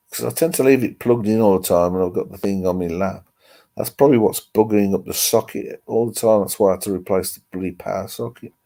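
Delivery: ordinary talk, nothing marked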